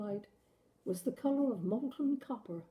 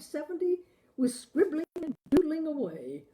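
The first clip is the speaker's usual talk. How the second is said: rising, louder, breathless amazement; mounting wonder; 5 semitones higher, 5 dB louder than usual. Acted. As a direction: let the wonder spill out, louder and higher. George marvels at the stranger.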